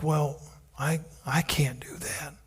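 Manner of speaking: soft unvoiced whisper; whispered doubt. Believer doubting himself, whispered. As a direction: whisper it like a frightened thought. Voicing fearful self-doubt.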